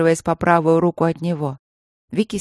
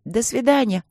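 put-on higher piping child's goodbye; squeaky little girl goodbye; 6 semitones higher than usual. A narrator's reading. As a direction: chirp it like a small girl. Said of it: "запищали девочки".

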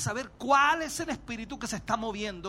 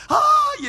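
loud cry; 13 dB louder than usual. Acted. Cry out in sermon.

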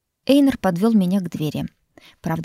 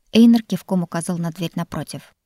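ordinary delivery, nothing marked

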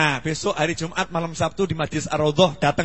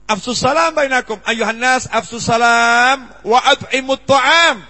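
emphatic recitation; 6 semitones higher, 9 dB louder, slower than usual. Real, not acted. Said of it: "hadith recited emphatically".

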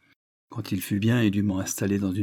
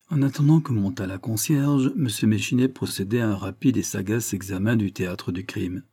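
plain reading voice

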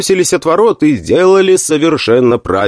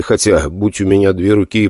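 ordinary delivery

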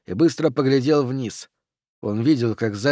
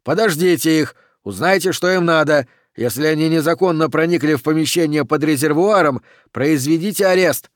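raised, urgent commands; shouted orders; 4 semitones higher, 4 dB louder than usual. Acted. Captain instructs his officers below, shouting orders. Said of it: "крикнул он".

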